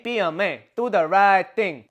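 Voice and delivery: emphatic cartoon Chinese-accent exhortation; mock Chinese accent. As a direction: belt it out in a put-on Chinese accent. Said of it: "I don't know why I said Chinese accent".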